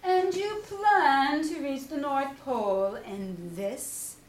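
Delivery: a know-it-all looking down at the sled; doubtful Owl. Acted. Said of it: "asked Owl".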